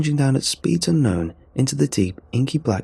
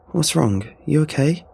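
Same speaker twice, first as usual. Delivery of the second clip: worried, raised questions; concerned; 7 semitones higher than usual. Acted. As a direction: ask in quick alarm.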